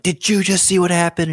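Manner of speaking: high-pitched, incredulous question; acting out amazement to a buddy; stunned retelling. Paramotor pilot telling his buddy after landing, stunned and high-pitched.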